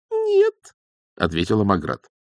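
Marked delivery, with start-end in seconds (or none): 0.1-0.7 very high little no; squeaked refusal. Acted. Young witch defies the elder's order, squeaking. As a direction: refuse in a tiny high pipe.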